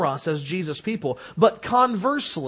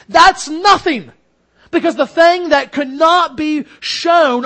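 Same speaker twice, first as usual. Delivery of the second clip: louder, higher and slowing; raised preaching voice; impassioned; 5 semitones higher, 5 dB louder than usual. Real, not acted. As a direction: preach it hard, building. Preacher builds to passionate peak.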